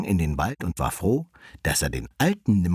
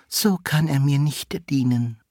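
high-pitched lady fox; high, prim refusal; vixen; 7 semitones higher than usual. A narrator's reading. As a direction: turn him down in a high vixen voice. Vixen rejects the suitor.

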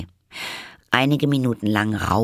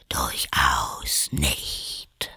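caterpillar; 6 semitones lower, much slower than usual. A narrator's reading. The caterpillar coolly contradicts Alice in a whisper. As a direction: dismiss her in a low whisper.

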